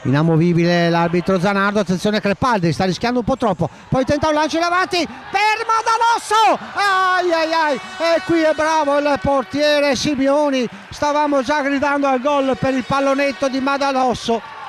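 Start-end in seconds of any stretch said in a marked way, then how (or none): 3.9-14.6 high, rising excitement as the lob nearly goes in; excited call. Real, not acted. Commentator calling a near goal for the crowd, excited. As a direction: call the chance like it's about to be a goal.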